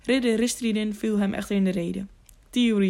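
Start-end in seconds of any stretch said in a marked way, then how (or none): none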